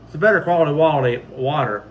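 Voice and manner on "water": ordinary talk, nothing marked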